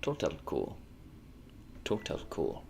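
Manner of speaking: put-on low, soft German-accented catchphrase; imitated German accent. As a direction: mimic the German teacher under your breath. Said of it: "a thing that she said a lot".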